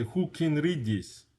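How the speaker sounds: English as a Russian learner says it; thick Russian accent. Shown as a demonstration. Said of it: "that's a thick accent".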